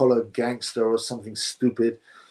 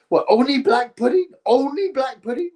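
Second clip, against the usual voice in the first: high, laughing disbelief; incredulous laugh; 10 semitones higher, 3 dB louder than usual. Real, not acted. To chat, a high, laughing, incredulous reply.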